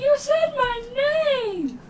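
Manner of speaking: high, slow, tearful joy; overjoyed sobbing cry; proud happy crying. Acted. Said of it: "Calcrix starts to cry now".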